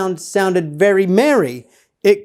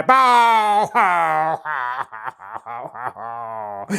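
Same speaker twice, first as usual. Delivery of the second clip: fading, dying boo-hoo wail; mock wailing Whos; 3 semitones lower, much slower than usual. Grinch mocking the crying Whos, wail fading out. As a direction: let the wail sink away to nothing. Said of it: "the Who's down in Whoville will all cry".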